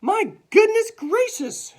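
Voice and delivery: very high, loud, drawn-out exclamation; shocked shout. A narrator's reading. Fallen teacher on the floor, high shocked shout. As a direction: shriek it slowly in shock. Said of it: "she shouted".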